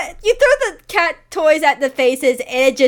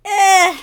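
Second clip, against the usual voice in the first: high cackle; 8 semitones higher, 7 dB louder than usual. Acted. Spirit laughs at player.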